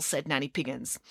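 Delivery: ordinary delivery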